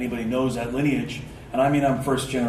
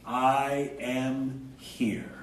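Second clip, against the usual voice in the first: slow, drawn out; 5 dB quieter, much slower than usual. Shown as a demonstration. Teacher showing the group the phrase, slowly. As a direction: say it slowly, each word apart. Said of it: "And simply say".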